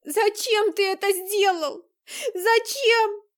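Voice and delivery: high, loud, slow sobbing cry. Acted. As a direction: wail the question through tears. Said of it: "прорыдала Настя".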